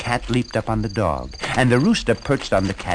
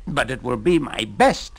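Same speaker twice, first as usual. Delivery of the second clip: ordinary delivery, nothing marked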